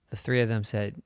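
ordinary delivery

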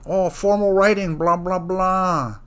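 high, whiny mock-pedant voice; hammed-up high mimicry of a fussy marker; mocking the TAs. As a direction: parody a nitpicking grader, drawing out the end. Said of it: "being like".

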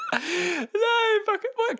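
high burst of laughter; laughing hard, high. Real, not acted.

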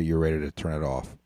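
normal talking voice

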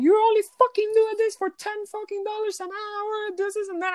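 very high screechy mimicry; imitated screaming. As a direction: scream it like the angry guest. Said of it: "she screams at me".

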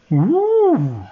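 high, loud amazed whoop; excited whoop. Acted. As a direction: whoop in wonder. Bob sees creature rise.

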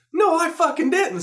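to his son's mom, a loud and indignant denial. Retold or imitated. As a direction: shoot up high and loud, protesting.

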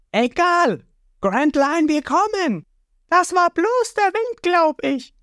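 high, breezy cartoon boy voice; carefree boy hero. Ruffy shrugging off the strange noise, high and carefree. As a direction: wave the worry off cheerfully.

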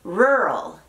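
"Rural" as one gliding RUR-ul, curled R; careful isolated "Rural"; English pronunciation. Shown as a demonstration. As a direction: model "Rural" slowly with American R. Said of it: "muy difícil de pronunciar".

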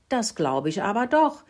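high, mildly taken aback; baffled piping. A narrator's reading. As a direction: pipe it up, a little baffled. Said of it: "fiebte Mokelinchen, etwas verblüfft". (leicht verblüfft gepiepst)

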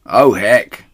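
loud let-down "Oh, heck"; disappointed. Acted. The big woman grumbles, let down about the tail. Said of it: "said the big woman".

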